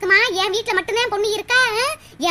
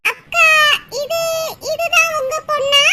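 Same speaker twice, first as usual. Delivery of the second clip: excited call; 7 semitones higher than usual. Acted. Neighbour spots the daughter.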